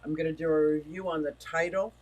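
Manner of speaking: ordinary delivery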